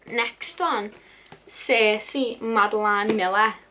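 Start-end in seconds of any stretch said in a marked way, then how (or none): none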